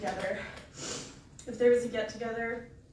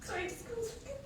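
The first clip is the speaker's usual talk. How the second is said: choking up; 12 semitones higher, 6 dB quieter than usual. Real, not acted. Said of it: "It's okay".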